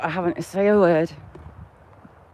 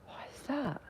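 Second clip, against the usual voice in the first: whisper; 3 semitones higher, 17 dB quieter than usual. Real, not acted. Hears a strange sound on a walk.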